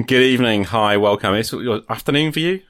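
usual speaking voice

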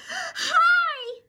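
very high, loud squealed hi; mock-squeal, held high; imitated gushing greeting. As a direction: mimic a friend's overexcited greeting. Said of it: "she was like".

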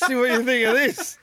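smug collector voice breaking into laughs; record snob, laughing. Retold or imitated. Mocking his friend as a laughing smug record snob. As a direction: show off a rare pressing, smugly. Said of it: "'cause I'd have gone".

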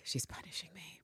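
breathy ashamed whisper. Retold or imitated. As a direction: whisper it like a guilty secret. Eve's shamed inner thought.

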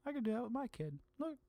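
high, soft, pleased little voice; mock-sweet high murmur to himself; imitated cooing delight. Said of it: "and I'm like".

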